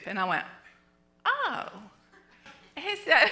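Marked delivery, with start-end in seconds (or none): 1.2-1.7 sheepish oh. Retold or imitated. Realizes husband is right.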